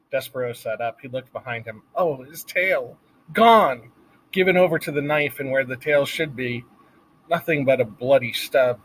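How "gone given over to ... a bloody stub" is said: usual speaking voice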